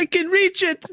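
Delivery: high, strained squeak; mock straining. Mocking Elsa's reach, high strained squeak. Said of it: "You know, like".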